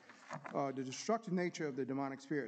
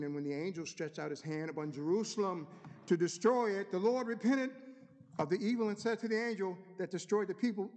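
building, raised Bible-reading voice; scripture reading; 5 semitones higher, 4 dB louder than usual. Acted. Preacher reading of the angel over Jerusalem, building scripture reading. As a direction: declaim the verse, lifting toward the climax. Reads scripture aloud to congregation.

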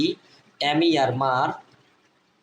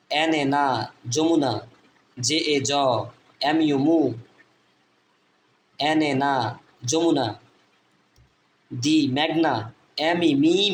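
ordinary talk

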